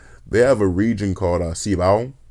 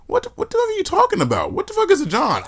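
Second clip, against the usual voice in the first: baffled, much higher pitch; high-pitched incredulous questioning; retold bafflement. Answering little brother's slang, baffled. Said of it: "I'm just like".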